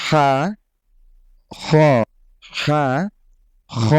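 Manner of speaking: হা breathy, খ thick and guttural, alternating; airy হা then heavy খ; Arabic letter contrast. Shown as a demonstration. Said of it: "আমি আবার বলছি".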